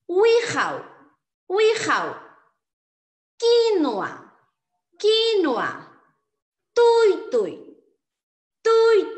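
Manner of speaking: each word called out high and loud, falling at the end; loud, drawn-out word-by-word demonstration; model pronunciation. Shown as a demonstration. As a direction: dictate each word for learners, first syllable held high. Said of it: "Voy a reproducirlo nuevamente".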